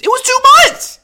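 pissed, voice shooting an octave up; octave-up angry yell. Shown as a demonstration. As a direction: shout it, voice leaping way up high. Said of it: "When my voice goes up an octave, octave, you know I'm pissed".

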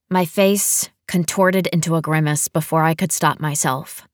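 ordinary delivery

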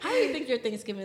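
quick, laughing question. Real, not acted. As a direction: ask it fast through a laugh. Laughs asking co-host.

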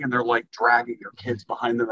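normal talking voice

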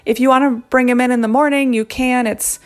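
a patient nurse explaining gently. Retold or imitated. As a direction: nurse talking the mother out of the ER, light imitation. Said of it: "And she keeps saying".

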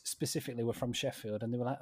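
ordinary talk, nothing marked

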